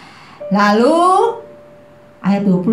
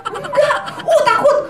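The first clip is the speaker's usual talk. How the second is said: mock fear; 11 semitones higher, slower than usual. Acting scared disciples.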